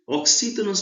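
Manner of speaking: Oxítonas called out high and loud, stress on the TO; teacher stressing Oxítonas; stressed teacher word. Shown as a demonstration. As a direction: announce Oxítonas with a lift on the stressed syllable. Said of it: "que é a sílaba mais forte".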